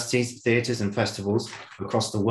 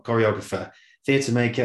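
ordinary talk, nothing marked

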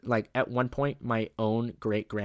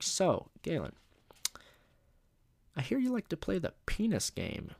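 mock-interrogating principal; 8 semitones higher than usual. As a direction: toy with a nervous student, pitch swooping up. Principal toying with nervous student.

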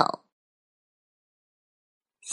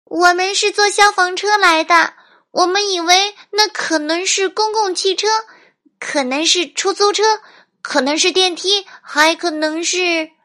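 put-on high, loud little girl voice; child explaining; 11 semitones higher, 5 dB louder than usual. A narrator's reading. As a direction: pile up excuses in a piping kid voice. Narrator voices girl explaining to captain. (高亢的小女孩辩解声)

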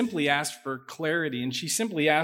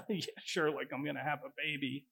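soft, dry mock disbelief; sarcastic scoff; 11 dB quieter than usual. Pastor imitating Sarai scoffing at the promise of a baby, softly sarcastic. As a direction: brush it off like a joke you don't buy. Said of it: "which was basically a scoff".

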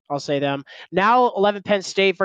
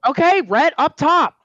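excited call; 12 semitones higher, 5 dB louder than usual. Real, not acted. Calling for a high five.

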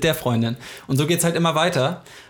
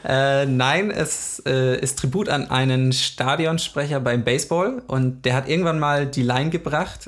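normal talking voice

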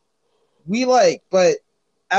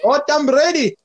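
put-on Dominican accent; 9 semitones higher, 5 dB louder than usual. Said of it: "I can't do a Dominican accent".

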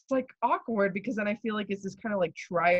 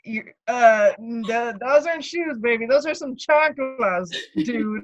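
mock outrage; 5 semitones higher, 9 dB louder than usual. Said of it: "and I'm like".

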